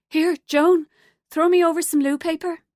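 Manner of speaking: calling out. A narrator's reading. Woman in a cubicle calling to her friend, loud and high. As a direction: shout over the stall wall.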